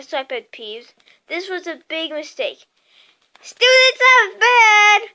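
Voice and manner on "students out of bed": high, full-force drawn-out bellow; loud tattling yell, stretched out. A narrator's reading. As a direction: sound the alarm down the halls. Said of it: "Peeves bellowed".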